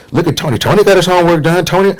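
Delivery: high, gushing teacher imitation; praising teacher. Teacher praising Tony before the class, high mock-gushing. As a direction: hold up the model pupil in a high put-on voice. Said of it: "the teacher was always congratulating Tony".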